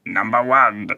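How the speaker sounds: annoying alien giving slow orders; stilted alien command, word by word; Monoid voice. Acted. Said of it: "The Monoids are more like".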